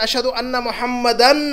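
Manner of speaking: "Muhammadan" with a long final "an"; stressed "an" ending. Shown as a demonstration. Teacher quoting the azan, drawing out "an" in "Muhammadan". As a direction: hold out the "an" closing "Muhammadan". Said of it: "कभी ज़बर लग रहा है".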